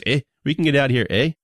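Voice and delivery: mock Canadian accent with a lifted "eh". Co-host imitating his buddy's promise in a Canadian accent. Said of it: "In his Canadian voice".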